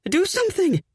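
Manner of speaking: high, desperate plea; pleading. Acted. Fiancée begs Kip to deal with bird, high desperate plea. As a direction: beg him to act, voice way up. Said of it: "I plead".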